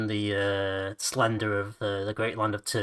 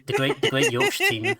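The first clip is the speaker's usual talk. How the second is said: mock grandeur; 6 dB louder than usual. Mock grand Yorkshire announcement.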